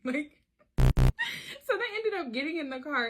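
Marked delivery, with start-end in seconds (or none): 1.6-3.1 laughing. Real, not acted. Laughs while telling.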